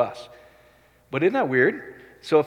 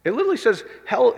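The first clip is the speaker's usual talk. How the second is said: ordinary talk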